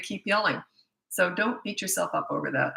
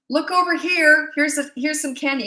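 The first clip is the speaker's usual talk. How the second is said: coaxing parent; 9 semitones higher, 4 dB louder than usual. Retold or imitated. Parent diverting a naughty kid with candy, high coaxing. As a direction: lure the kid away with candy, sing-song. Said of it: "and you say to them".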